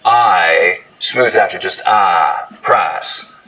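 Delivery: price said slow as prahs; Southern price smoothing. Shown as a demonstration. Host showing Southern price smoothing. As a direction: flatten the I into ah. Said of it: "price smoothing in much of the South".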